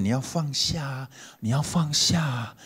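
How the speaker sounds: slow, lightly sing-song teacher's coaxing; a hint of a gentle monk urging; lightly voiced monk gently telling him to let go; preachy advice voice. Mimicking a Buddhist teacher's advice.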